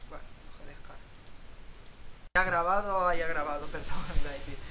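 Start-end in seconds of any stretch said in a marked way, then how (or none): 0.1-1.0 breathy whispered mutter, much softer; resigned whisper while the game downloads; whispered aside. Real, not acted. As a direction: mutter it under your breath to yourself. Repeats phrase, second time muttered.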